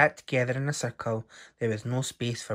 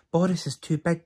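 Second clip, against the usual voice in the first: higher, lightly raised little mouse cry; a small mouse blurting in alarm; 6 semitones higher, 3 dB louder than usual. A narrator's reading. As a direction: call it out like a worried little mouse. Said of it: "cried one little mouse".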